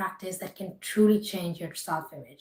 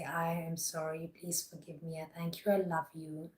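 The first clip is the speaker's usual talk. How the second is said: guided meditation; 10 dB quieter than usual. Acted. Repeated affirmations.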